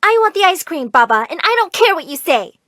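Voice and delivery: put-on high, insistent child's protest; mildly angry, stubborn outburst; angry defiance. A narrator's reading. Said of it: "I angrily grabbed the cone".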